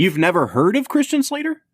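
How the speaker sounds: real disbelief, climbing high at the end; can't believe what you just heard; incredulous question. Real, not acted. Host to co-host who doesn't know the actor, incredulous.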